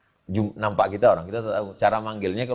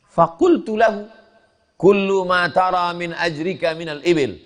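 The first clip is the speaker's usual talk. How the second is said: high, loud storyteller's voice, slowed on the Arabic; a preacher acting out the worker's return; raised dramatic preaching; 11 semitones higher, 4 dB louder than usual. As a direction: lift the voice up high and proclaim it. Worker returns to claim wages.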